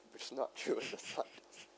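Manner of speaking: breaking into laughter while denying it; amused denial dissolving into laughter; laughing protest. Real, not acted. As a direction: protest through helpless giggles. Laughs at own retold story.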